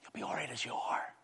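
whispered mock reassurance; soothing whisper. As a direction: comfort them in a hushed whisper. Mocks soothing of sinners.

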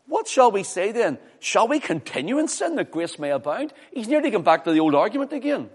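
full-force sermon delivery; fiery preaching. Acted. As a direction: preach it hard to the congregation.

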